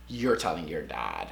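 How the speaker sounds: very low, quiet mom voice; imitated mom, dropped low; stern mom order. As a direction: lay down a hushed, deep command. Mom's final order, retold.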